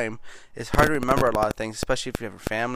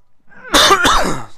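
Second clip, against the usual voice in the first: harsh dry coughs; hacking cough from a dry throat; coughing fit; 12 dB louder than usual. Real, not acted. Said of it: "Sorry, I'm coughing a lot".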